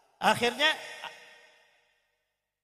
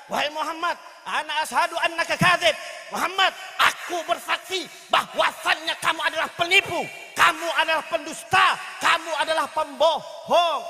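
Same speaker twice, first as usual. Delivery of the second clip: high, loud, hammering accusation; Mut'im bin Adi shouting; 5 semitones higher, 6 dB louder than usual. Acted. Mut'im denounces Muhammad before the startled crowd, shouting. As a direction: hurl each charge louder, as a public denunciation. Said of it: "Mut'im bin Adi teriak".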